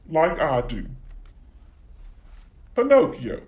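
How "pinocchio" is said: proud Italian-accent title call. Shown as a demonstration. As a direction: announce Pinocchio in an Italian accent, higher. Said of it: "do my best Italian accent today".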